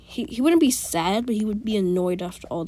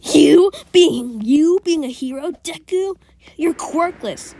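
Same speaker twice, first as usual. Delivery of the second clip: much higher, loud jeering voice; sneering mockery; 8 semitones higher, 8 dB louder, slower than usual. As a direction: laugh at Deku's dream. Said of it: "And be like".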